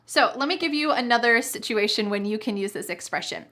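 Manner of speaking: ordinary talk, nothing marked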